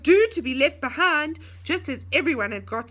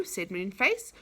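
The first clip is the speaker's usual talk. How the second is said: ordinary delivery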